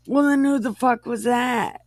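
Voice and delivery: shocked outburst. Acted. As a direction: blurt it out in disbelief, pitched high. Isaac realizing he was tricked.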